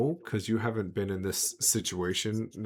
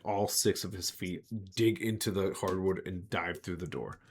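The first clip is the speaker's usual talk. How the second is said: ordinary talk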